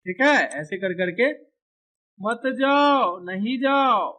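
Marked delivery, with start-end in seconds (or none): 2.2-4.1 high, long-drawn acted plea; calling after someone. Character begging another to stay, drawn-out calling. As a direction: draw out each word, pitched up.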